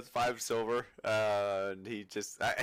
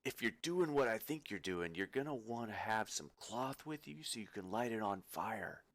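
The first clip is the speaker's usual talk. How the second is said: softer, knowing shopkeeper's hint; conspiratorial aside; 7 dB quieter than usual. Acted. Shopkeeper hinting slyly to a customer, conspiratorial. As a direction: lean in and confide it quietly. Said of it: "leans in closer to you and almost winks".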